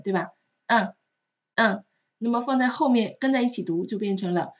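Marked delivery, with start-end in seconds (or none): 0.7-1.8 UN alone, slow, no N heard; silent final n. Shown as a demonstration. Teacher repeats the unlinked word, n silent in UN. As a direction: say UN slowly, stopping on the nasal vowel. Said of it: "这个呢是不发音的".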